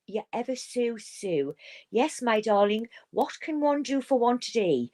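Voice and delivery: a put-on posh English telephone voice; posh Queen's English. Speaker mocks posh Londoners, in a lightly posh English accent. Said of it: "You know, that kind of speak".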